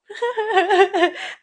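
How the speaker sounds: a loud, high burst of laughter; emotional laugh. Real, not acted. Tearing up while telling viewers, laughing loudly. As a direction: laugh it out as feelings spill over.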